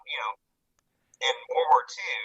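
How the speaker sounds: ordinary delivery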